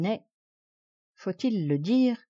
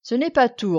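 louder, higher-pitched interruption; imperious demand; 5 semitones higher, 6 dB louder than usual. A narrator's reading. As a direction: press the beaten man for more. Victor presses further demands. (ton impérieux)

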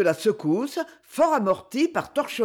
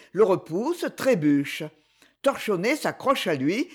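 ordinary delivery, nothing marked

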